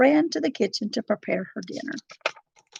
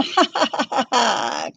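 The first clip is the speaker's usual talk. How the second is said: wicked triumphant cackle; evil cackle; 3 semitones higher than usual. A narrator's reading. Queen over the fallen princess, evil cackle. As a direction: cackle at her victory. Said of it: "cackled the queen".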